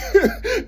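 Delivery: quick high laugh with a rise; high bursting laugh. Real, not acted. Laughs at the taxes joke.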